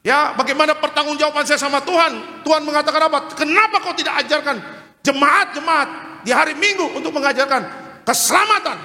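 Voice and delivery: high, insistent pulpit voice; urgent sermon rebuke; fervent preaching. Acted. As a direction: rebuke the church like God would. Preaching, voicing God's rebuke.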